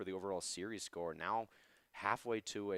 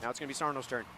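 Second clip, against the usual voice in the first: high, excited esports commentary; live play-by-play; 6 semitones higher than usual. Acted. Co-caster follows saves and shots, excited play-by-play. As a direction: ride each shot up in pitch, then settle.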